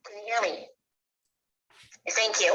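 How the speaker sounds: ordinary delivery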